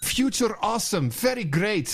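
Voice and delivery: self-satisfied fake European executive accent; mock European accent. Host parodies the delighted project namers, mock European accent. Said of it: "Your accent is great".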